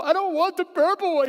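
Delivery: put-on high, loud, wailing child's refusal; dad acting out the toddler refusing the purple plate; whining toddler. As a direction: mimic the kid changing his mind. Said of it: "And I go over to the drawer".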